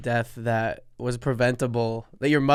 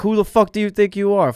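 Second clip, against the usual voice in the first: louder, higher mock outburst; mock angry yell; 8 semitones higher, 7 dB louder than usual. Co-host imitating the angry driver, mock yell. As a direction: do a put-on road-rage yell. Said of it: "You just yell".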